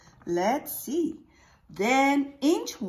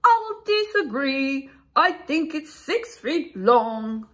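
raised, louder disagreeing bug voice; beetle's objection; 4 semitones higher, 6 dB louder than usual. Acted. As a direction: argue as a small stubborn beetle. Beetle character voiced in story.

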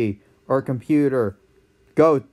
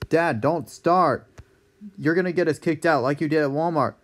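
normal talking voice

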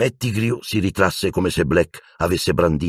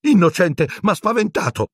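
squeaking; 14 semitones higher than usual. A narrator's reading. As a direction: squeal your innocence in panic. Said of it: "squittì Pettigrew". (Pettigrew squittisce acuto e forte)

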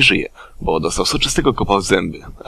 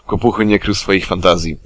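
ordinary delivery

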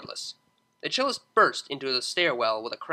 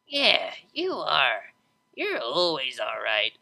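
put-on slow slurred drunk drawl; tipsy DJ teasing her friend, slowed; drunk Vinyl; slower than usual. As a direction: grin through it, lazy and slow.

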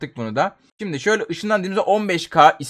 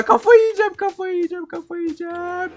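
high, fading repeated wail; going crazy whine; 12 semitones higher than usual. Real, not acted. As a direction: whimper that you're losing your mind. Losing it in game.

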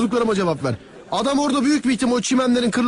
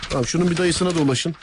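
normal talking voice

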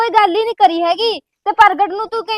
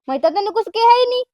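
usual speaking voice

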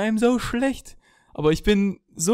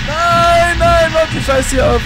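furious yelling; 9 semitones higher, 9 dB louder, slower than usual. Real, not acted. Yells at glitching game.

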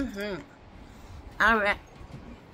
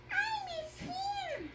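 very high, soft whimper and wail; pretend crying toy; 13 dB quieter, slower than usual. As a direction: cry as a tiny sad toy. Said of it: "Don't cry".

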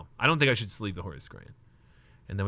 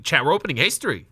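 louder, pumped-up declaration to chat; hyped announcement; 5 semitones higher, 8 dB louder than usual. Real, not acted. Streamer hyping his chat over a big pack opening, excited. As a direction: sell the big moment like a hype man.

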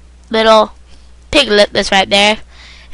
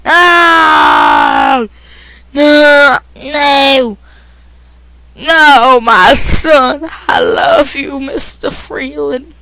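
mock-dramatic wail, loud and raised; hammed-up anguished shout; 3 semitones higher, 6 dB louder, much slower than usual. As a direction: cry out as if losing a son in a melodrama. Acted grief for son in game.

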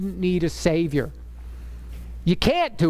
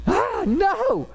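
high-pitched wail of protest; playful anguish, pitched way up; mock dismay; 12 semitones higher than usual. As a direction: groan out a hammed-up no.

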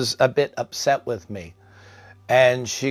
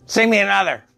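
higher-pitched imitation of a patient; demanding old patient; 7 semitones higher, 3 dB louder than usual. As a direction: give a short, high-voiced order.

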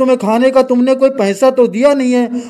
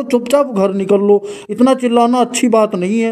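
ordinary talk

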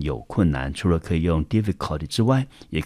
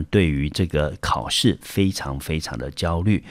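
ordinary talk, nothing marked

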